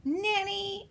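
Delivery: high-pitched mock scream; mimicking scream. A narrator's reading. Eloise copying her tutor's scream, high mimicking call. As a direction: echo the call way up high, not full volume. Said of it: "And then I scream".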